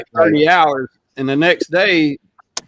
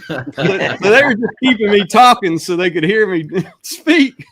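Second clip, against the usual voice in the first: higher voice breaking into real laughs; talking through laughter; 5 semitones higher than usual. Real, not acted. Man telling friends about his accent, laughing as he talks. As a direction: laugh your way through the words.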